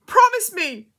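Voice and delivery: very high, loud demand; near-scream, desperate; almost screamed. A narrator's reading. Girl in labour gripping the woman's hand, almost screaming. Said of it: "she almost screamed".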